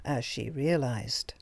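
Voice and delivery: plain reading voice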